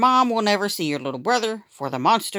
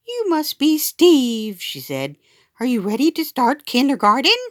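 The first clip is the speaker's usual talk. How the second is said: sweet teacher; 8 semitones higher, 4 dB louder than usual. Acted. Kindergarten teacher greets child.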